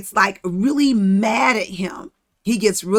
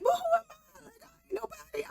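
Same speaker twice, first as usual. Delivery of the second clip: high, cracking, pleading wail, much higher; whiny Moses; 12 dB quieter, faster than usual. Retold or imitated. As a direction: wail it like a scared nobody begging off.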